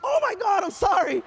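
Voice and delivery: very high, mock-mortified outburst; shocked apology. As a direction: imitate someone horrified at their own blunder. Connie imitated realizing her mistake.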